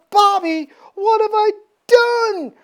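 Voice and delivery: high, loud mock cry of horror; hammed-up anguished outcry. Warren realizing he killed his friend, acted horror.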